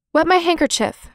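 quick, high command; brisk order. A narrator's reading. Jess ordering Violet to fetch water, brisk and high. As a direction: snap out an instruction, no time to waste. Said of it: "Jess ordered briskly".

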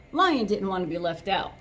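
ordinary delivery, nothing marked

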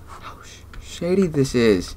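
hushed whisper to herself. Real, not acted. Player sneaking through the dark house, whispering. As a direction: whisper so nobody hears.

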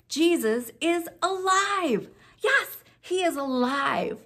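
high, drawn-out, jubilant announcement; joyful proclamation. Real, not acted. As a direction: proclaim it with rising excitement, then celebrate. Joyful Easter announcement to children.

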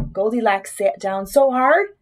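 ordinary delivery, nothing marked

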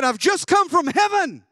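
father bursting with news from heaven; excited proclamation. Retold or imitated. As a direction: announce it in breathless triumph. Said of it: "He said".

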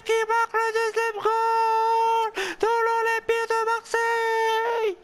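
very high, loud screaming goal call, drawn out slow; ecstatic goal call. Acted. Streamer losing his voice screaming Payet's second goal. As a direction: scream the brace like the stadium is shaking.

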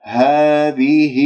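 هذه slowly, with held aa and final ii; natural long vowels. Shown as a demonstration. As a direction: stretch both long vowels of هذه evenly. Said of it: "مدود طبيعية يجب أن نأتي بها". (مد طبيعي في هذه)

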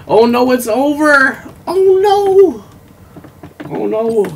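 high squealing oh no; mock panic. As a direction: shriek it in cartoon alarm. Video runs out, mock panic.

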